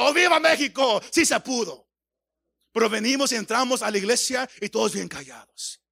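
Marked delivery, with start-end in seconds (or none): 0.0-1.9 high, loud mock football cheer; imitated crowd shouting. Preacher imitating church members cheering the World Cup, shouted cheer. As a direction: shout it like fans after a saved penalty. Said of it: "Todos estaban gritando".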